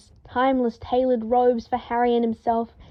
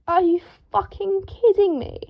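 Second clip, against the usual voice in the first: higher, outraged disbelief; furious; 9 semitones higher than usual. A narrator's reading. Draco furious, examining the rip in his robes. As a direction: seethe at the torn silk. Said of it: "Draco said furiously".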